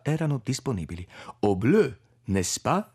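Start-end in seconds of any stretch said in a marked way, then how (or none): 1.4-2.8 mock French accent on "Au bleu"; "Au bleu" in a fussy imitation French accent; a light French accent borrowed from Utz; imitating Utz's French. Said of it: "cercai di imitare la stramba pronuncia francese di Utz".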